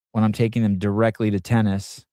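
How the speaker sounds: ordinary talk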